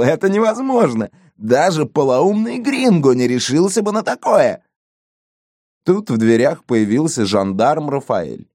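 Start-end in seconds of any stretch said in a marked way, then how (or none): 0.0-4.6 high-pitched disbelief; incredulous outburst. A narrator's reading. Police chief refusing to believe the gringo dared, incredulous. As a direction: protest it as impossible, pitch lifted.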